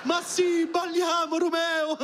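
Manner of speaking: she-cat giving in. Acted. The rich she-cat agreeing to dance with Romeo, high and flirty. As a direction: coo it like the posh cat saying yes. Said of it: "E lei ci va".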